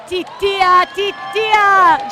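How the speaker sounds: high, loud, thrilled call of the name; excited calling out. Real, not acted. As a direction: cheer the winner's name. Jockey wins, commentator calls out.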